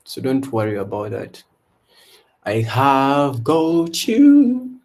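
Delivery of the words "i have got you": ordinary delivery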